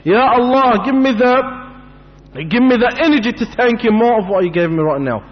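high, urgent, repeated praise; impassioned supplication, pitched high; fervent thanksgiving prayer. Acted. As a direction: implore God with mounting fervour. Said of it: "should thank Allah Azza wa Jal hundred times".

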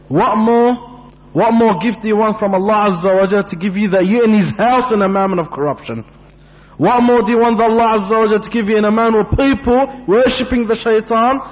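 fervent sermon crescendo; impassioned preaching. Real, not acted. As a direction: press the congregation with insistent questions. Impassioned rhetorical questions to congregation.

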